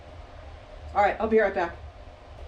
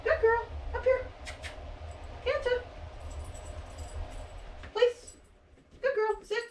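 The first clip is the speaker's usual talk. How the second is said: high coaxing voice; 9 semitones higher, 7 dB quieter than usual. Real, not acted. Calling dog up onto the place.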